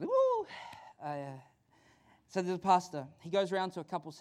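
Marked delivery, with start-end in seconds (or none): 0.0-0.5 high, loud "woo"; excited whoop. Real, not acted. Preacher back after five weeks away, excited whoop. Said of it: "I'm just a little bit excited".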